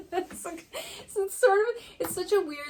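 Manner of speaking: high-pitched, giggly disbelief; cracking up at the absurd anticlimax; laughing. Real, not acted. Laughs at her own anticlimax.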